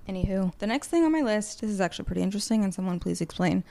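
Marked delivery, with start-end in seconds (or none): none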